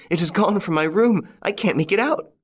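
put-on a fretful young man reporting a loss; a hint of panic in a young man's voice; young nephew, upset. A narrator's reading.

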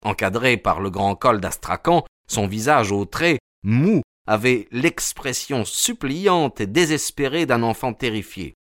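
ordinary delivery, nothing marked

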